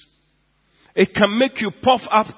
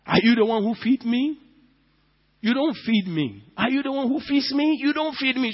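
proud retort; 7 semitones higher than usual. Retold or imitated. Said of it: "They talk like that".

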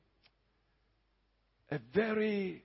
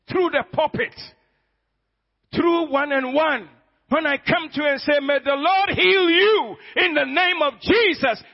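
loud, higher preaching blessing; preaching at full force; 6 semitones higher, 6 dB louder than usual. Acted. Pastor blessing the congregation, loud proclaiming preacher voice. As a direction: proclaim it over them with power.

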